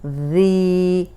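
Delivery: ज़ी held out very slowly; long drawn zee. Shown as a demonstration. Teacher modelling the drawn-out ज़ी for students. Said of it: "वो खड़ी जेर जी".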